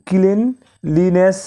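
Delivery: cleanliness said slowly, each syllable apart; slow syllable-split demo. Shown as a demonstration. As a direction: stretch cleanliness into its three syllables. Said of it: "এটি তিনটি অংশে ভেঙে".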